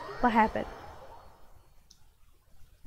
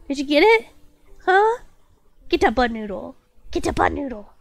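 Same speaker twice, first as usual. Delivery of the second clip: ordinary talk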